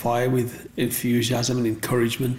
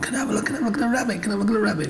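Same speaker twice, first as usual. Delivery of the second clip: put-on much higher, fast pleading child voice; eager kids; 11 semitones higher, much faster than usual. Kids crowding round to see the rabbit, high fast begging. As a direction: beg like an impatient schoolkid. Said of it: "all the kids would come".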